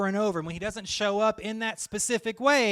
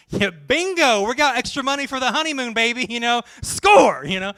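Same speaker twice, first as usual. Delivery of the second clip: bright, rising and louder, ending in a shout of score; gleeful gloating; 5 semitones higher, 8 dB louder than usual. Acted. Host couple gloating over saving money on wine, excited and loud. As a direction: gloat like you just beat the system.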